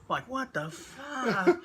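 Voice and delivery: high, slow, drawn-out incredulity; mock disbelief. [name] reacts to the wrestler's insult, mock disbelief. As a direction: stretch it out in stunned disbelief.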